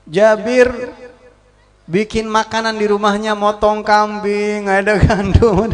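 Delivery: loud, slow calling out; loud announcement. Acted. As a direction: announce the feast to everyone. (mengumumkan dengan suara keras)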